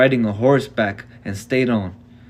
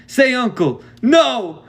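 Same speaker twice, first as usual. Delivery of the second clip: much higher, louder cry of pain; 13 semitones higher, 5 dB louder, slower than usual. A narrator's reading. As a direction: cry out as the arm twists. Boy in agony.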